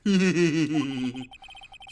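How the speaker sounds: rolling cackle at his expense; mocking laugh. Visitor laughs at jailed friend.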